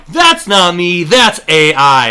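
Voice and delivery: loud mock denial. A caught celebrity making excuses, loud mock denial. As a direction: blurt out the excuse in mock outrage.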